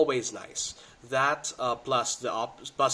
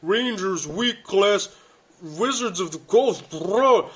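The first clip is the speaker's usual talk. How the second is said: mocking complainer; 8 semitones higher, 9 dB louder, slower than usual. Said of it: "everyone makes jokes about rangers".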